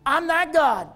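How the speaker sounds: shouted high preaching climax; full-force preaching peak, shouting. Real, not acted. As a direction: cry it out to the brother over there.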